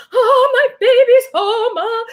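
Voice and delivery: loud, very high mock-mom cooing; gushing mom. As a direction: squeal like an overjoyed new mother, drawn out. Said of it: "Most moms would be like".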